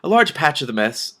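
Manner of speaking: usual speaking voice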